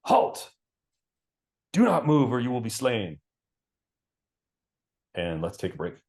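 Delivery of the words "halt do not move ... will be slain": loud stern halt from the trees; stern forest guard calling out a threat; commanding guard. Acted. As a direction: bark the warning like a hidden sentry. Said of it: "a voice in the trees saying".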